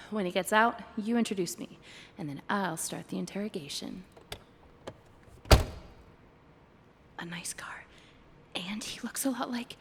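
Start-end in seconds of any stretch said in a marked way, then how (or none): 5.5-9.7 slow, breathy aside; whisper. Acted. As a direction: whisper it so he can't hear. Whispering about buyer.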